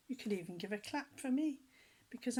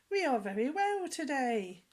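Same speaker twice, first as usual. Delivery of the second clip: put-on children's answer; 5 semitones higher, 7 dB louder than usual. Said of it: "And we said".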